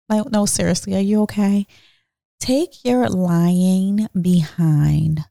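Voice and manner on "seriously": usual speaking voice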